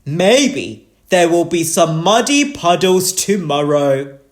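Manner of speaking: loud, hearty father pig; Daddy Pig. A narrator's reading. Daddy Pig promises puddles for tomorrow, loudly. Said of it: "Daddy Pig said".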